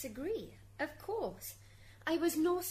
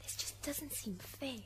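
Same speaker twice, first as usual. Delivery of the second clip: whispered complaint; 7 dB quieter than usual. Acted. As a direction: whisper it to your counsel. Whispered aside to counsel.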